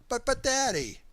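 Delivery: high, loud frightened plea; pleading scream. A narrator's reading. Child begging Daddy mid-whipping, high and loud. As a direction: cry out begging Daddy to stop. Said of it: "I screamed as the belt buckle".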